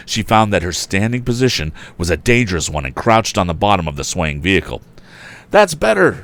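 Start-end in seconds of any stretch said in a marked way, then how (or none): 5.5-6.2 high, loud encouraging shout. A narrator's reading. Tom shouting encouragement to the woman, high. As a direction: call praise over the rattle. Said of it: "shouted Tom".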